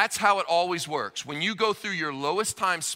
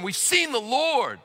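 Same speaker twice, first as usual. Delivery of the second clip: excited announcement; 6 semitones higher, slower than usual. Acted. Disciples excitedly tell Thomas.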